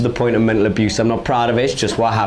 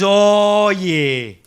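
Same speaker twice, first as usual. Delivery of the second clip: high, cocky street con man; high-pitched con man sizing up a mark; mixtape salesman; 6 semitones higher, slower than usual. Acted. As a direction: read them like a hustling salesman. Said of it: "And he goes".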